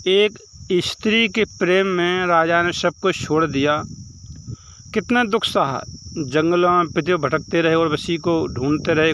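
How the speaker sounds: ordinary delivery, nothing marked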